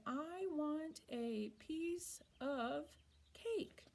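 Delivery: very slow, every word separated; I want a piece of cake spoken word by word, of said fully; slow written form. Shown as a demonstration. Teacher reading the written version, very slowly. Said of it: "we slow down and we say".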